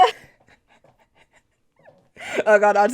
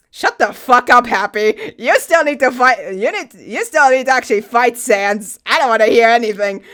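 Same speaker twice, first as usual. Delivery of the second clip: mock angry yell; 3 semitones higher, 8 dB louder than usual. Real, not acted. Yelling at game characters.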